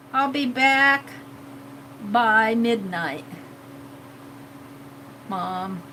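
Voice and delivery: slow, dropping, sweetly drawn-out princess promise. Acted. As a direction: let it trail off low and soft on Mom. Redoing the princess line in character.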